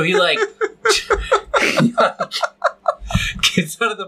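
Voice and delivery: high breathy laugh through the words. Real, not acted. Laughing while telling his friend the story. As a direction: cracks up mid-sentence.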